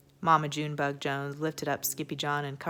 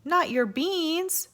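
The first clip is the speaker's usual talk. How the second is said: high, slow outraged cry; 12 semitones higher, 3 dB louder, much slower than usual. Acted. Scapito outraged that the bandit steals beans, high and slow. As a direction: stretch it out in shock. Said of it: "cried Scapito, outraged".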